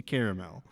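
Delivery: caramel as CARE-uh-mel; full pronunciation. Shown as a demonstration. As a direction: give caramel all three syllables. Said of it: "I think it's supposed to be".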